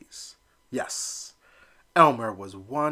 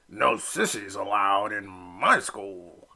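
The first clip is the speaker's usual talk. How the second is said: bully squawk; 3 semitones lower, slower than usual. Acted. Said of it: "Drake squawked".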